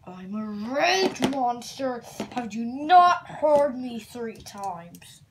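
slow booming annoyance; exasperated monster. Acted. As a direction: lay it down slowly, fed up. Monster repeats itself angrily.

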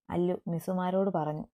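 usual speaking voice

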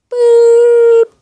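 sudden loud high "boop" yell; jump-scare shout of "boop". Pretend. Sudden joke yell, then just joking.

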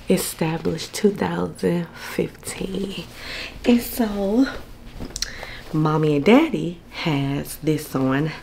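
usual speaking voice

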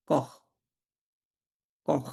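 "কখ" repeated with a throaty kh ending; kh sound for CH. Shown as a demonstration. Teacher drilling CH after O, "কখ" with kh. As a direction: finish each "কখ" on kh. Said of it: "খ এর উচ্চারণ হবে".

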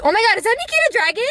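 high fast starstruck exclaim; excited. Retold or imitated. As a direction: blurt it out starstruck. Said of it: "I was so loud".

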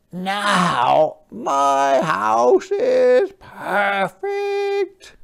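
high, slow, joyful cheer. Acted. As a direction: cheer with delight. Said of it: "cheered Hermit Crab".

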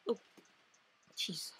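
ordinary talk

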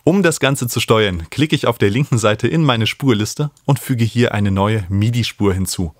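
ordinary talk

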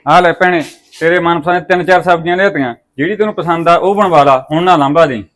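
high, loud hearty call. Acted. Brother calls out loudly to his sister with vegetables. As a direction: boom it out to your sister.

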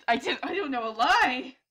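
normal talking voice

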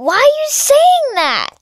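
very high, drawn-up play protest; mock exasperation. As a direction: squeal the question in pretend outrage. Plushie exasperated by constant meowing.

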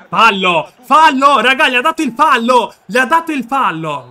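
loud, high furious yell at the ref; real shouted protest; outraged shout. Real, not acted. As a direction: explode at the decision. Outraged at the referee's foul call.

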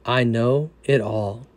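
soft, low and calm; gentle reply. Retold or imitated. Jesus gently reassuring the anxious sinner, soft and low. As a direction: answer quietly, with tender reassurance.

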